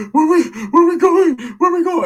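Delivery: high, loud, eager woman's voice; eager tia. Retold or imitated. Aunt perking up about going for food, eager. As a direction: sound suddenly excited about food.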